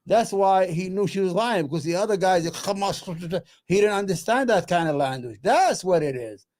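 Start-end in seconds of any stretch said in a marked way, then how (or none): none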